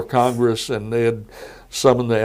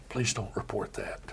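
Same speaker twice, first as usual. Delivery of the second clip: much softer, confidential aside; hushed request; 13 dB quieter than usual. Retold or imitated. Agent quietly asking the reporter to keep it secret, hushed.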